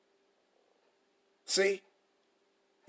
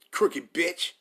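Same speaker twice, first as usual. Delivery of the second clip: ordinary talk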